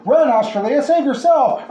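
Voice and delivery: high, loud desperate scream. A narrator's reading. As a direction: yell a warning to save her. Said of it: "Fred screamed".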